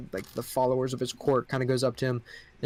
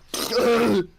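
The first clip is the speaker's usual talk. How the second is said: creature cackle; 9 semitones higher, 6 dB louder than usual. Acted. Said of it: "the other one goes".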